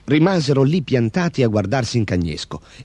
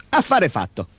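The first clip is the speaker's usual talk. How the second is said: usual speaking voice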